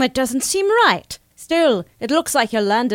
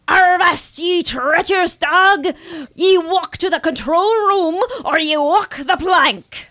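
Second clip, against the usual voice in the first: pirate voice; 3 semitones higher than usual. Pretend. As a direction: bark it like a space pirate. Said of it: "very well done, very well done".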